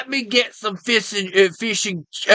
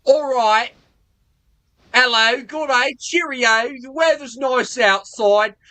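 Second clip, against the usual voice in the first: slightly higher, hammed-up British accent; mock British accent; 4 semitones higher than usual. Mock British accent, ordering in an American restaurant. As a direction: put on a cartoon posh British accent. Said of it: "my version of a British man".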